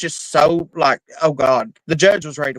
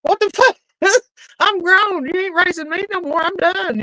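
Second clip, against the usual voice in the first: retold outburst at dad; 13 semitones higher than usual.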